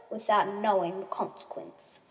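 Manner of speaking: plain reading voice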